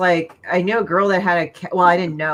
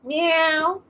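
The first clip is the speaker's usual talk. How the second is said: put-on high sweet cat voice calling to her baby; 10 semitones higher, much slower than usual.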